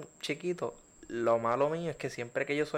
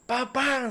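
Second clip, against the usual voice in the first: gunshot sounds; 8 semitones higher than usual. Retold or imitated. Imitates gunshots in story.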